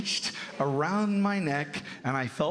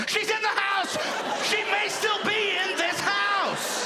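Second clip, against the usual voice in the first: much higher, slow panicked yell; panicked kid yell. Retold or imitated. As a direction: howl it like a terrified child. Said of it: "I go".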